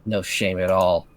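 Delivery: low, scornful grumbling; bitter low disdain. Acted. Catherine bitter about the lovers.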